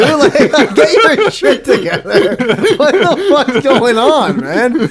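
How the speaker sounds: high, loud laughing disbelief; cracking up while scolding. Real, not acted. Laughing at friend's choking.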